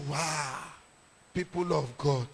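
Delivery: low, soft greeting to the congregation; quiet, deep retold opening; subdued address. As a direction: drop low and hushed, as if reenacting.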